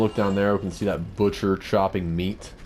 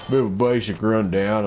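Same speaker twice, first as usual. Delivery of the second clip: put-on sudden playful Southern US accent, a bit louder; Southern drawl; 3 semitones higher, 4 dB louder than usual. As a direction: slip into a hammed-up Southern twang. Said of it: "getting all southern on you".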